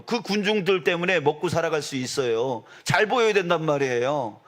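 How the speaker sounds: ordinary delivery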